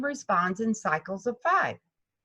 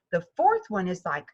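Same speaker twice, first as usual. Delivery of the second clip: ordinary talk, nothing marked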